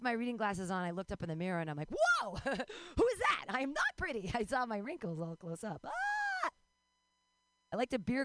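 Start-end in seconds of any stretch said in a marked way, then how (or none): 1.9-4.3 high, drawn-out horrified yelp; hammed-up startled outcry; mock shock. Shock at own mirror reflection. 5.8-6.5 very high pretend scream; short hammed-up shriek; mock horrified scream. Horror at seeing her wrinkles.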